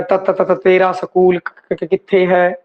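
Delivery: low, slow stutter on first consonants; demonstrated stammer. Shown as a demonstration. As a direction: repeat the t and k before the word comes. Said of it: "ਤੁਤਲਾਹਟ ਦੇ ਕਾਰਨ ਵੀ". (ਤੁਤਲਾਹਟ ਨਾਲ ਅਟਕ-ਅਟਕ ਕੇ)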